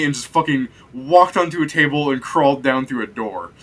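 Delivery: ordinary talk, nothing marked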